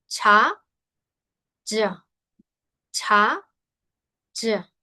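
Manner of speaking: "Cha" aspirated then "ya" without air; contrasting Chinese sounds. Shown as a demonstration. Teacher drilling students on the aspirated contrast. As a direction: alternate the breathy and the no-air sound.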